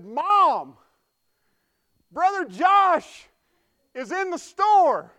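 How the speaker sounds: put-on high, slow, drawn-out child's shout; imitating a boy announcing the pastor; loud little boy. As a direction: call across the store to Mom. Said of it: "he said quite loudly".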